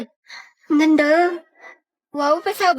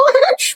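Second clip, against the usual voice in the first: high, short giggle; flustered high-pitched laugh; 10 semitones higher than usual. Acted. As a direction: giggle while being teased. Teased about crush.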